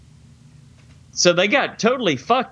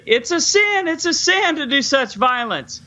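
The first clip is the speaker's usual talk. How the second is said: much higher, hammed-up pleading young man; mock pleading Alex; 8 semitones higher than usual. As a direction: beg like a scared boy, in fun. Alex pleads to doctors, retold.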